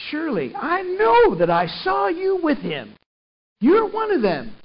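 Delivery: high, loud, slow accusing holler; retold holler. Servant girl calling Peter out by the fire, loud holler. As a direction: holler it at him, sure this time. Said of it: "hollered one more time".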